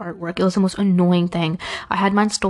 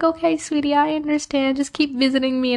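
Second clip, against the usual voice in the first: much higher and gentle, lightly voiced kind teacher; sweet teacher; 7 semitones higher than usual. Math teacher hugging the student who dropped her class, gentle and higher. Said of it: "She was like".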